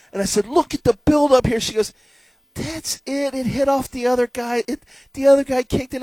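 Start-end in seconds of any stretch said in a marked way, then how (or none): 0.5-1.6 high, loud eager pointing; husband urging his wife to watch the buildup; excited. Retold or imitated. 2.5-6.0 high, deadpan put-down; mock the goal; wife dismissing the own goal, voiced higher; unimpressed wife.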